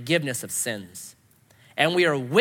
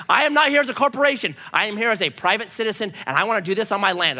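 raised, insistent proclamation; mock defiant declaration; 3 semitones higher, 3 dB louder than usual. Addressing the county planning department, mock defiant. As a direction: announce it with comic self-importance.

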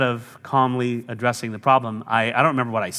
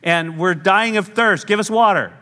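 higher, whiny complaining crowd; grumbling Israelites; 5 semitones higher than usual. Acted. Israelites whining at Moses in the desert, complaining. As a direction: whine at Moses like a hungry mob. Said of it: "they start grumbling and complaining".

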